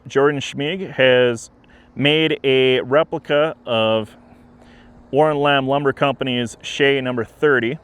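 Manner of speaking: ordinary talk, nothing marked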